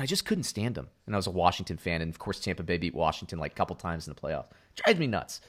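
normal talking voice

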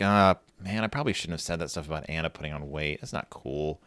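low, soft thinking aloud; quiet self-reproach, voiced for a character; guilty muttering. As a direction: trail down low as if regretting it. Voicing Sister's guilty second thoughts.

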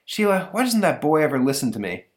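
whiny dad; complaining father. Retold or imitated. Father complaining to his wife, a mild whine. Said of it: "father complaining to wife".

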